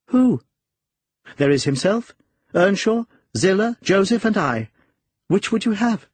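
high-pitched female character voice; high, lively character voice for a woman; voiced woman character. A narrator's reading. Narrator voices the woman answering him.

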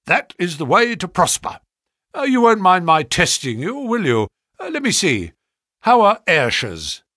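high, loud businesslike patter; praise, then briskly test him; brisk agent, approving. A narrator's reading. Voices brisk visitor.

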